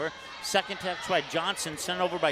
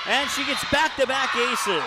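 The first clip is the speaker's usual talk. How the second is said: much higher, louder thrilled call; excited shout; 7 semitones higher, 7 dB louder than usual. Real, not acted. Announcer shouts back-to-back aces to viewers, thrilled. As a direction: erupt over the second ace. Calling a second straight ace.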